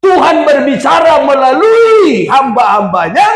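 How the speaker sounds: high, urgent pulpit proclamation; fervent preaching. Real, not acted. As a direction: proclaim it to the congregation with full force. Preaches at full force.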